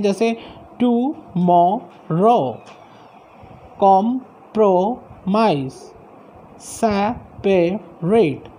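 टुमारो, कॉम्प्रोमाइज, सेपरेट drawn out slowly, each word split into three syllables. Shown as a demonstration. Teacher modelling trisyllabic words for the class, slowly. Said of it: "three sound units में जो है break कर सकते हैं".

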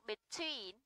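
between with the weight on TWEEN; light be, stressed long TWEEN in between; stress on second syllable. Shown as a demonstration. Teacher models a preposition, between stressed on TWEEN. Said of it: "trọng âm phải rơi vào tween".

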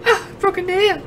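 put-on high, slow vain girl voice; prissy girl voice. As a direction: play a pampered, vain girl. Mocking the character's vanity.

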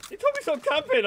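high, loud outraged comeback; indignant shout. Real, not acted. To a teammate who told him off, an outraged shout. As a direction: throw the teammate's words back, yelling.

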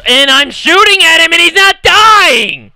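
loud, exasperated shout at the screen; frustrated yell. Real, not acted. Gamer yelling at an enemy who won't die, frustrated shout.

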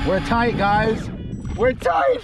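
thrilled, rising shout; hooked a fish, buzzing; excited. Real, not acted. Fish hooked on first drop.

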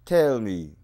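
sudden loud call, tell me; loud demand. Acted. Poet loudly demands the listeners answer. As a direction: bark it out at the crowd.

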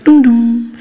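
sung two-note airport chime; chime imitation. Comic imitating the airport chime before an announcement. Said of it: "She'll come on the pier and be like".

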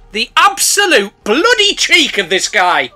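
loud, slow outrage; outraged. Real, not acted. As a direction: spit out mock outrage. Outraged exclamation.

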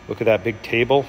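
ordinary delivery

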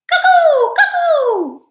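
very high, loud cuckoo call; cuckoo clock. A narrator's reading. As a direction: hoot shrilly like a cuckoo clock.

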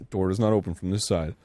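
ordinary talk, nothing marked

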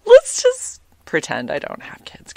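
sheepish, whispered mock plea; embarrassed whisper. Podcaster acting out her reply to a date about kids, embarrassed whisper. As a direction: half-whisper it, as if confessing with embarrassment.

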